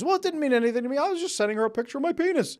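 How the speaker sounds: high, shrugging brush-off, the second line fast; dismissive excuse. Retold or imitated. Cheater waving off his partner's hurt, high and dismissive. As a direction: brush it off like it's nothing.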